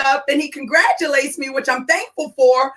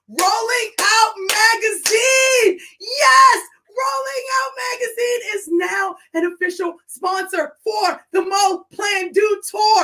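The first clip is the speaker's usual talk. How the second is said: higher, slow, thrilled announcing; excited announcement; 6 semitones higher than usual. Real, not acted. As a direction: announce it bursting with joy. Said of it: "I can't contain it".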